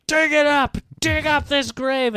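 high, loud mock crowd chant; mob shout. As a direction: imitate a mob demanding action. Acting out an angry crowd.

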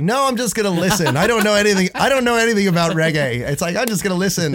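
younger self, pitched high and bright; high-pitched quoting. Retold or imitated. His younger self declining, raised pitch.

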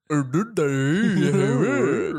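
slow, drawn-out imitation of a friend; Zane impression. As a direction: stretch the words out lazily like a friend does. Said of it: "This is how Zane always speaks".

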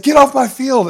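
high, loud furious order; angry shout. Retold or imitated. Coach throwing the pitcher off his field, furious yelling. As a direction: yell it at full force to banish them.

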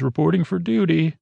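imitating a StarCraft unit's line; StarCraft Terran unit. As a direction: lift the pitch, like a Terran unit reporting in. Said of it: "like a Terran in, in StarCraft".

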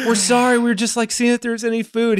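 put-on high-pitched, apologetic kid voice; sheepish apology. Caught kids explaining to friend's dad, high sheepish apology. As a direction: imitate kids meekly excusing themselves. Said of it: "And we were like".

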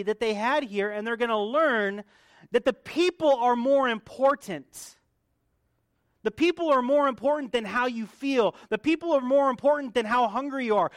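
loud, high, hammered refrain; preaching at full force. Acted. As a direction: drive the point home to the congregation. Preaching climax.

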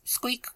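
a shy little squeak; tiny squeak. A narrator's reading. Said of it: "With a soft voice".

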